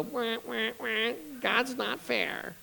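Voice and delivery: high, soft, drawn-out crybaby wail; mock pouting whine. Preacher mimicking someone pouting in the corner, mock crybaby whine. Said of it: "you gonna go pout?".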